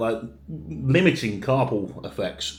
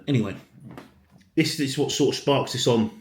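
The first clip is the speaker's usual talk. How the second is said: ordinary talk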